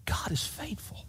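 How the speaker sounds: very soft, voiceless hush; reverent whisper. Real, not acted. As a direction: whisper it as a tender assurance. Same phrase repeated in a whisper.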